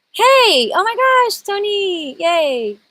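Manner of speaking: very high, squealing delight; excited greeting. Real, not acted. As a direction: greet a friend who just turned up, thrilled. Greeting a friend who joins.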